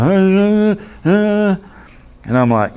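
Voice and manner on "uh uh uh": high, strained halting grunts; struggling uh sounds from a stroke patient; stroke victim's grunts. Retold or imitated. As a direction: mimic a man who can't get words out. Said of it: "and he's like".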